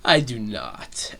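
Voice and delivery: a slow, low, measured mock refusal; Bowser. Villain answering the penguins' demand to yield, low and slow. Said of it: "And Bowser's just like".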